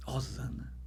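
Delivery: unvoiced, ghostly whisper of the name; hushed voice from nowhere; whispered echo. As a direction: breathe the word like a far-off call. Echo of the mysterious voice.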